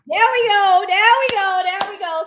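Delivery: loud, high there we go; cheering the right answer; excited cheer. Real, not acted. Host cheering when the comments guess right.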